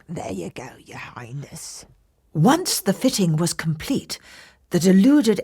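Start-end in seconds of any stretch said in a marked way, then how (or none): 0.1-1.8 soft, hushed whisper; sly whispered flattery. Acted. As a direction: breathe it like a secret. Impostor finishing fitting.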